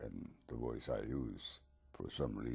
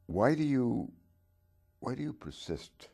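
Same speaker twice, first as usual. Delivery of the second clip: pressing question; 12 semitones higher, 9 dB louder than usual. Acted. Interviewer presses Dracula.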